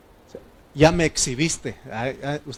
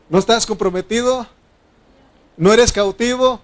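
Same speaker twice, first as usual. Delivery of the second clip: raised, ringing pulpit voice; preaching at full force; 5 semitones higher, 6 dB louder than usual. Acted. Preacher urges the church about Christ's triumph, at full force. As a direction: preach it out loud to the congregation. Preacher drives home the text.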